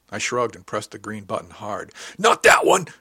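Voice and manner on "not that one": sudden high, very loud shout; alarmed yell. A narrator's reading. Officer stopping crewmate pressing the wrong button, alarmed yell. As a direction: yell it out to stop someone. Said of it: "Mister Von Nichols yelled".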